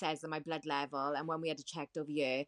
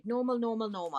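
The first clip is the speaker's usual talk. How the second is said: a higher, lightly mocking repeat of the nurse; 6 semitones higher than usual. Retelling the nurse's favourite word as a higher, mild mock. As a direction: echo her pet word back with a sneer. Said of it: "That's their favourite word".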